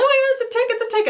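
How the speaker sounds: high squeal of alarm; high retold squeal on finding a tick. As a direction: squeal in sudden panic. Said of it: "I start squealing".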